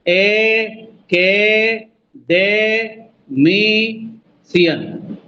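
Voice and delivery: एकेडेमिशियन stretched very slowly, each beat held; drawn out. Shown as a demonstration. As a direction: say it syllable by syllable. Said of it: "पांच वाला हो गया।". (एकेडेमिशियन खींचकर)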